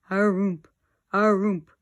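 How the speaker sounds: loud, drawn-out ghostly thump sound; creaky ghost noise. A narrator's reading. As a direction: boom it out like a haunted house creak. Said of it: "like that".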